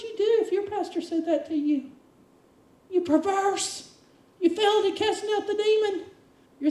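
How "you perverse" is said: slow mock rebuke. Repeating rebuke slowly.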